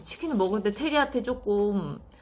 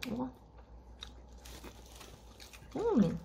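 chewing between words; talking while eating; mouth full of chicken; 3 semitones higher than usual. Real, not acted. Seen 0: Bites chicken earlier; mouth still working on food while speaking, drumstick in hand.

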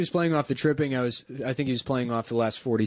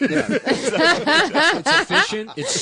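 laughing; 14 semitones higher, 5 dB louder, much slower than usual. Retold or imitated. Mock praise, high laughing.

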